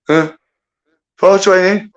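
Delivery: high, loud, jolted-awake disbelief; mock alarm at being woken up; startled confusion. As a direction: blurt it out like you've just woken in a panic. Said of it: "I'm like".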